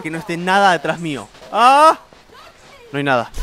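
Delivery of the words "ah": sudden high, loud yelp of fright; startled scream. Real, not acted. Gamer afraid something is behind him, screaming in fright. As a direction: scream as if something grabbed you.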